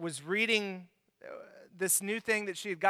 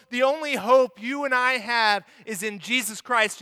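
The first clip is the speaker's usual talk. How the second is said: loud, ringing preacher's proclamation; full-force preaching; 2 semitones higher, 7 dB louder than usual. Acted. Pastor proclaiming to the church, loud and ringing. Preaching climax on God's love.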